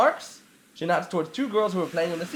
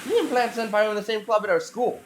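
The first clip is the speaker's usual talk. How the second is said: ordinary talk, nothing marked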